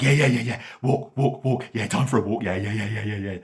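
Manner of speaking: loud, high, yappy eager dog; excited dog. A narrator's reading. Dog thrilled about going for a walk, loud and high. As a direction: bark out the yeahs like a thrilled dog.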